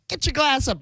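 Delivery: a hint of a slurring Southern drunk, high; drunken slurring. Drunk man turns to Mike for a toast, mock slurring. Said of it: "He's mumbling and slurring".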